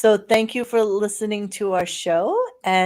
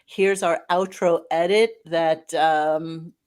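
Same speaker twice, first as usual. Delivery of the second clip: ordinary talk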